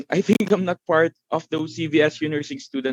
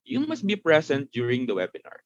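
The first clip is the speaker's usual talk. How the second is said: usual speaking voice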